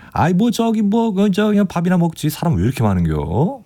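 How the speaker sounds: 충청도 아저씨 사투리로 정겹게; old men's Chungcheong dialect. Retold or imitated. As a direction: play a chatty Chungcheong uncle in the restroom. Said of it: "말씀들이 너무 정겹게 느껴졌거든요".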